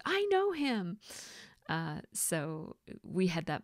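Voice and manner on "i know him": high, thrilled with stress on know; high, delighted I know him; Buddy the Elf. Retold or imitated. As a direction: gush it like a starstruck elf. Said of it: "And then he says".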